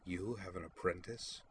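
soft, knowing arch question; archly. A narrator's reading. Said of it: "inquired archly".

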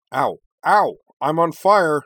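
loud, high yelps of alarm; startled dismay. A narrator's reading. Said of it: "sounding more startled and dismayed than actually hurt".

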